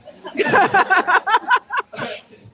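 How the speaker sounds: loud high laugh; big laugh. Real, not acted. Handler laughing loudly at the crowd. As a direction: burst out laughing.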